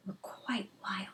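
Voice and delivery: awed whisper; hushed. A narrator's reading. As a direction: breathe it out in awe. Narrator hushes on wild eyes.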